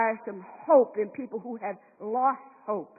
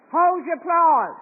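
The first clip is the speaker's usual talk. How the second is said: ordinary delivery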